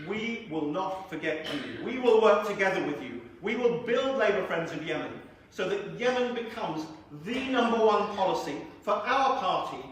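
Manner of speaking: emphatic oratory rising to a loud finish; rousing closing pledge. Real, not acted. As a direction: build deliberately towards the applause.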